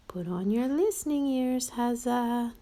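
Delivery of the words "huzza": ordinary delivery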